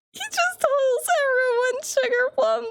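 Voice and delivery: high squeaky mock sweet-talk; mock sugary voice. Streamer mocking a character's pet name, high squeal. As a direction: squeal it like a cloying lover.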